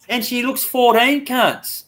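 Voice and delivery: high, mocking quoted voice; mock shocked girl. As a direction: parrot her in a high sneering tone. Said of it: "she goes".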